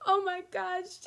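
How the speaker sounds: high, loud laughing cry. Acted. Cracking up while quoting the friend, high and loud. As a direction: break into laughter mid-quote.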